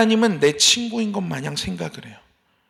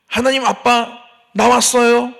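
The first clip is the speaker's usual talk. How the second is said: put-on childish greeting; 4 semitones higher, 5 dB louder, slower than usual. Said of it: "이렇게 하는 거예요".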